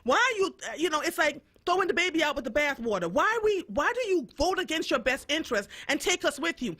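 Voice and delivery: normal talking voice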